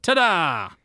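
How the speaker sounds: high, showy ta-da; mock triumphant flourish. Heroine showing off the bullet, mock triumphant. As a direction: present it like a magician's reveal.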